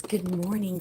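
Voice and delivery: ordinary talk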